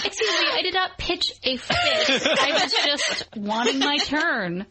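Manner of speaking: giggly mock indignation; laughing through a playful denial; laughing protest. Real, not acted. Laughing mock protest defending herself.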